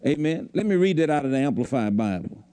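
ordinary talk, nothing marked

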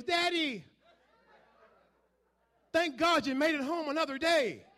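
put-on a touch of a grateful kid's voice; child voice. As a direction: play the happy child at the ankles. Kids greeting father in story.

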